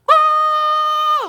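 high, loud crying wail; anguished wail. Retold or imitated. Kneeling teen breaking down, loud high wail. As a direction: let out an ugly sob-shout. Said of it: "I just started crying".

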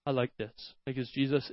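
ordinary talk, nothing marked

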